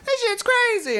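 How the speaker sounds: very high, loud, laughing fake panic; fake hysterical caller. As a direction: ham up a shrieking prank victim. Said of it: "call in and be like".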